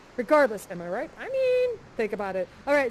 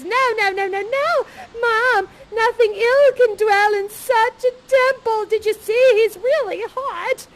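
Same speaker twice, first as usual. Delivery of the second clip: loud, higher, tearful begging; pleading Miranda; 7 semitones higher, 7 dB louder, slower than usual. Acted. Miranda begging her mother to spare Ferdinand, tearful. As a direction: plead with mother, half-sobbing. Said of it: "she jumps in front of her mother and says".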